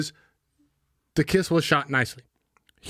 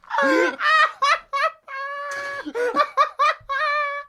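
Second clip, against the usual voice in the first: extended high-pitched cackling; long helpless laugh. Real, not acted. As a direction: laugh till it hurts. Laughing fit.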